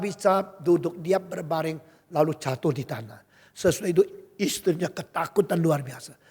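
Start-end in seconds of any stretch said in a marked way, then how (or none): none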